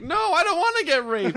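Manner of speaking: high, loud, laughing mock panic; scared Pinocchio. Pinocchio cornered in the prison shower, high laughing mock panic. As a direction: squeal it in fake terror through laughter. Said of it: "He's like".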